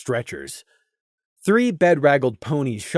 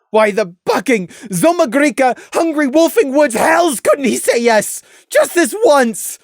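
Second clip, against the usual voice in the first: raised and louder, anguished; anguished curse; 12 semitones higher, 6 dB louder than usual. Acted. Crimson cursing Prey's refusal, loud anguished. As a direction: spit out the curse in despair.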